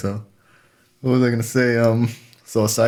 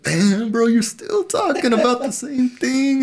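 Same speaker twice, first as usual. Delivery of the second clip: high-pitched, slow, fed-up whine; exasperated mock complaint; slower than usual. To a friend who keeps repeating the same story, a high, slow, fed-up whine. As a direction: stretch it out like you're sick of hearing it. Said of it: "and it's like".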